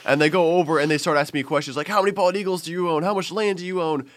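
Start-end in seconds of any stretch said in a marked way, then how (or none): 1.9-4.1 high, eager mock questioning; retold excitement, pitched up; imitating excited British kids. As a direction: fire off questions as a starstruck British kid. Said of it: "get so excited over hearing an American".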